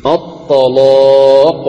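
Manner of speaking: slow, drawn-out recitation of الطلاق with a thick, velarized L; heavy lam. Shown as a demonstration. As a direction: chant الطلاق, stretching the heavy "laa" long. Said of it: "إذن تغلظ اللام".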